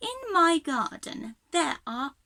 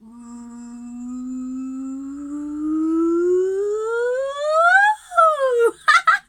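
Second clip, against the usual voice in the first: long rising glide; 7 dB louder than usual. Shown as a demonstration. Teacher makes the growing noise, a long rising glide. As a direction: stretch the growing sound up slowly. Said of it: "make the noise too".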